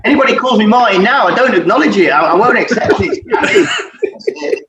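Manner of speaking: chuckling through the words; amused, laughing it off. Real, not acted. Laughing about his nickname.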